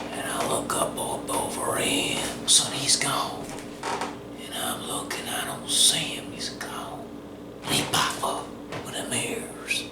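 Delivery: breathless hush as the creature vanishes; hushed storytelling. Retold or imitated. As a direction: whisper it like a spooky campfire tale. Hushed suspense in tall tale.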